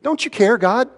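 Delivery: high-pitched appeal to God; desperate plea. Acted. Believer asking the Lord why he won't help, high desperate plea. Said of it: "Have you ever said that to the Lord?".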